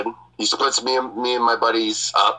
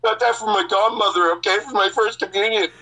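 put-on pleading teen; 8 semitones higher than usual. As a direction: beg him to believe you. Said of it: "And I'm like".

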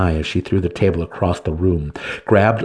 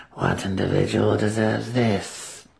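put-on hushed, breathy old crone; a withered ruler's cold greeting; ancient matron; 4 dB quieter, slower than usual. Matron Banray opens the council, a hushed ancient voice. Banray asks about punishment.

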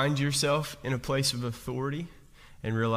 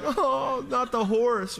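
much higher, mock-dismayed cry over the horse; dismayed moviegoer; 11 semitones higher, 3 dB louder, slower than usual. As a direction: gasp as if the horse is all that matters. Crowd mourns the horse.

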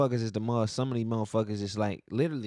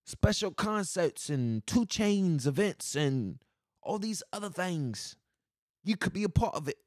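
higher, slow mock British accent; put-on "mate" accent; 5 semitones higher, slower than usual. Shown as a demonstration. Vlogger selling concerts and events, slow mock British accent. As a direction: stretch it out in a fake British accent. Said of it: "try and do this in my accent".

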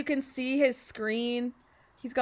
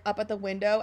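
ordinary delivery

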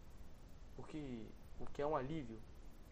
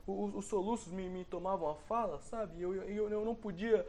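panicked sobbing; 6 semitones higher, 6 dB louder than usual. Retold or imitated. Retelling tearful childhood panic.

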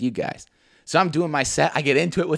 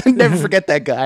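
ordinary delivery

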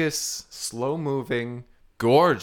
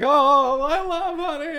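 much higher, slow delighted gush; excited gush; 14 semitones higher, 7 dB louder, slower than usual. Pretend. [name] to [name], gushing high over honey.

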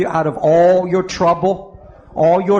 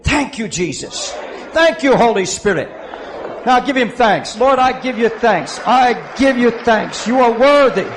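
raised voice of a preacher giving thanks; fervent thanksgiving prayer; 4 semitones higher, 3 dB louder than usual. Real, not acted. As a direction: lift up thanks to God with conviction.

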